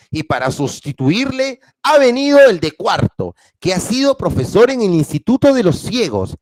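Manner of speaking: expressive classroom reading with voiced characters; storytelling to students; story read aloud. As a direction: read it to the class with feeling and intonation. Teacher reads story aloud to class.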